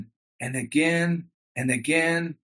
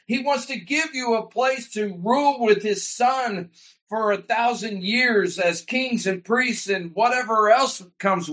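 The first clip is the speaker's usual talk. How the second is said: higher and higher, rising to a near shout; fervent pulpit build, higher by the end; building preaching; 6 semitones higher than usual. Acted. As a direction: preach it up toward a climax.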